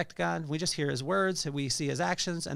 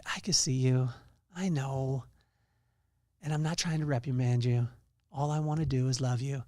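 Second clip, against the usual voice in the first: gentle, lowered, comforting voice; calm and reassure, keeping the voice low and tender; reassuring God; 3 semitones lower than usual. Acted. God comforts someone hiding in the storm, calm and reassuring. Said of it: "And God is saying".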